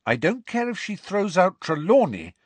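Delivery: high, insistent resolve; determined. A narrator's reading. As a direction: vow it firmly, building in force. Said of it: "said Hermione determinedly".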